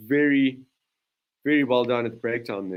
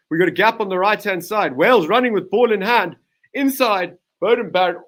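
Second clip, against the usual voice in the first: higher and louder, urgent match call; excited call; 4 semitones higher, 5 dB louder than usual. Real, not acted. Commentator calling a New Zealand counterattack, rising excitement. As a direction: ride the break as it opens up.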